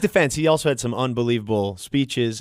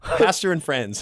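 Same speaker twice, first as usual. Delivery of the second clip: slow correction; much slower than usual. Real, not acted.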